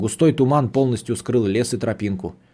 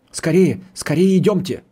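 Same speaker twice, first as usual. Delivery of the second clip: higher-pitched, pressing urgency; urgent hurrying; 6 semitones higher, 4 dB louder than usual. A narrator's reading. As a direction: hurry them along with a raised, insistent voice. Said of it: "торопил нас старик".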